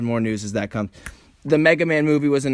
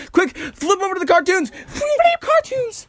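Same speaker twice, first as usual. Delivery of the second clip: falsetto; 3 dB louder than usual. Pretend. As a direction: sing the segue in falsetto. High sung segue.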